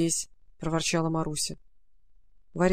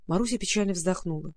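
plain reading voice

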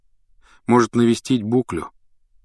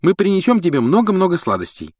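heartfelt; 11 semitones higher, 3 dB louder than usual. A narrator's reading. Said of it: "от всего сердца жалея Гарри".

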